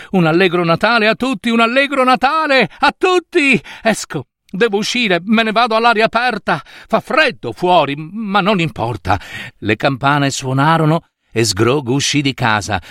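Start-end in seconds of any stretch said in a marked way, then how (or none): 0.1-9.2 high, elated Christmas greeting tumbling out; jubilant. Acted. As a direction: call it out overjoyed, pitched up high. Scrooge grida auguri di Natale. (esultante, pieno di gioia)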